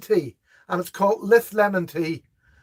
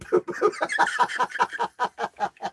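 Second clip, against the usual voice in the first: long breathy laugh at the joke; real laugh; 6 semitones higher, 3 dB quieter than usual. Real, not acted. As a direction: laugh on and on, almost silent.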